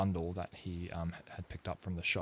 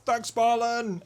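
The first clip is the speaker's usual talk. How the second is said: calling back; 9 dB louder, slower than usual. Acted. Crew calls back waving.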